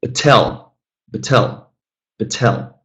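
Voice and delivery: "Batel" with the weight on TEL; wrong stress on second syllable. Shown as a demonstration. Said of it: "let's switch the stress".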